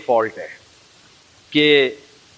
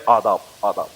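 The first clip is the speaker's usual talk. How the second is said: a lightly voiced tiny baby bowing politely, pitched lower; baby's polite greeting; 7 semitones lower than usual. Newborn greets the world with adaab, lightly voiced. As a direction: mimic a newborn's courtly adaab, a touch low. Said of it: "کہہ رہے".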